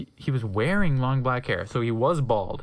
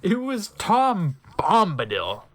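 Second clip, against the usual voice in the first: dramatic reveal; 3 semitones higher, 4 dB louder, slower than usual. Acted.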